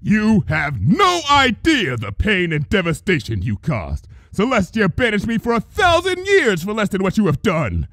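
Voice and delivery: high, booming royal fury; a goddess thundering her wrath; thundering princess. A narrator's reading.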